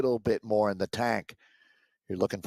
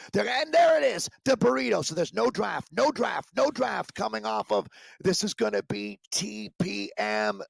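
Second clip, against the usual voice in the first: excited shout; 11 semitones higher than usual. Real, not acted. Commentator calling the final sprint, excited high shout. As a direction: call the finish with rising excitement.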